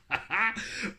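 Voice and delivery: high-pitched laugh. Real, not acted. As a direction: burst into a short high laugh. Laughs at the message. (rire aigu)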